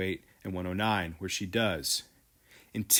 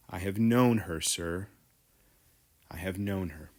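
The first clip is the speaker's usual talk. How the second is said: voice about to break; 3 semitones lower than usual. Acted. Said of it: "His voice is about to break".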